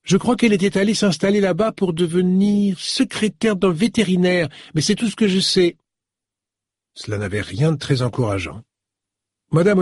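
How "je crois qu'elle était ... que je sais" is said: lightly voiced young woman, pitched higher; a hint of a girlish phone voice, higher; young woman's voice. Young woman on the phone recalls Celia, lightly voiced young woman. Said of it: "Une voix jeune et féminine".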